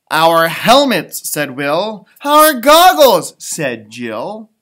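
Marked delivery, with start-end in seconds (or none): 0.1-1.3 loud, slow, eager announcement of the helmets; excited exclamation. Acted. Character quote in read-aloud book. 2.2-3.3 much higher, louder and slow, thrilled; excited exclamation. Acted. Character quote in read-aloud book.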